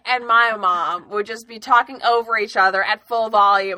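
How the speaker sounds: loud, emphatic family-chatter demo; full volume. Shown as a demonstration. Contrasts own family's full-volume talk.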